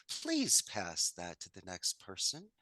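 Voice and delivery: ordinary talk, nothing marked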